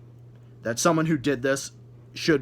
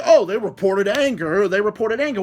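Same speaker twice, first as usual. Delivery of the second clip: sarcastic mimicry; 5 semitones higher, 6 dB louder than usual. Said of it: "oh, you're like".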